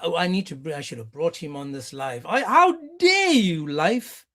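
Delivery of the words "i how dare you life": outraged. Real, not acted. Mock outrage at clip.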